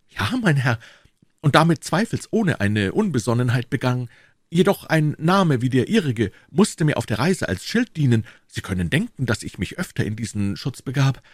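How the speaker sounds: put-on high, earnest young hero explaining himself; eager young D'Artagnan, confessing. Voices young D'Artagnan in dialogue. (eifriger junger D'Artagnan, höhere Stimme)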